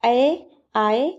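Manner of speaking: ए, आए drawn out very slowly, each vowel held; slow sound demo. Shown as a demonstration. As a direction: stretch out ए and आए so each vowel rings clearly. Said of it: "उच्चारण करते समय".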